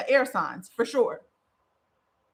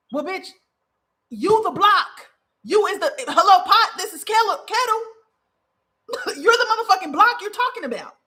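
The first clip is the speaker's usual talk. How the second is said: much higher, louder, laughing incredulous callout; 9 semitones higher, 4 dB louder than usual. Real, not acted. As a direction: call them out, squealing with laughter at the irony. Calling out a client, laughing.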